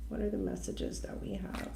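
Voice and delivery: soft voiceless whisper. Real, not acted. Reader whispering her question over the cards, voiceless. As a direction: murmur it privately to the deck. Asks the cards under breath.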